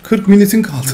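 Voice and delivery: amused by absurd game wording; laughing disbelief. Real, not acted. Reads game text laughing.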